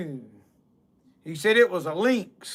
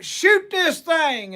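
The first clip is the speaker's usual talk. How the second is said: high, slow, drawn-out plea; desperate plea; 6 semitones higher, slower than usual. Retold or imitated. John in the tree pleading with Mister Barron, high slow desperate cry. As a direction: beg for rescue from the treetop.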